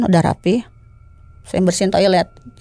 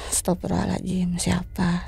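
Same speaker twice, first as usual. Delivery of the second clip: hushed, much softer aside; retold stunned murmur; 9 dB quieter than usual. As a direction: utter it under the breath, as if to yourself. Stunned reaction, said to himself.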